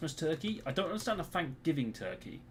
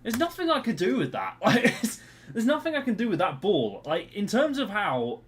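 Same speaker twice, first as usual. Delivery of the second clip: loud, higher-pitched exasperation; frustrated protest; 5 semitones higher, 7 dB louder than usual. Real, not acted. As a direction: protest loudly, at your wits' end. Frustrated protest during the game.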